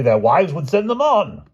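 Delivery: king furiously answering the queen. Acted. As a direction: fling the words at her. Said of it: "replied the King furiously".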